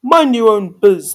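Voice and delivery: loud, slow rebuff; brusque princely reply. A narrator's reading. Prince dismissing Cindy's question, loud and brusque. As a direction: push each word out loudly. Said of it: "the prince replied".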